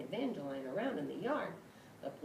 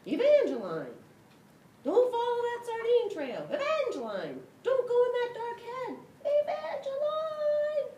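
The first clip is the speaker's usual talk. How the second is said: high, loud frantic yelling; frantic high shout, long cry on the last name; desperate shout; 12 dB louder than usual. Acted. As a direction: scream a warning to stop her. Dog shouts warning to cat.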